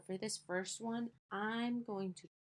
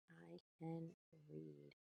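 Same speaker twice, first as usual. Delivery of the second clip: quiet slow reading; 12 dB quieter, slower than usual. Shown as a demonstration. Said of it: "teacher models sentence slowly".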